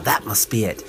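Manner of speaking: ordinary delivery, nothing marked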